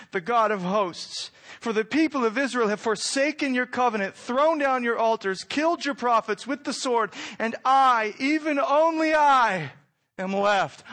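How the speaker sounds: high, loud lament; crying out. Acted. Preacher voicing Elijah crying out to God, loud and high. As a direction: cry it out to God. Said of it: "he cries out".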